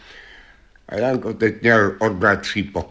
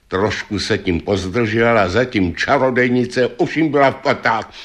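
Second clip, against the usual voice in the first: ordinary delivery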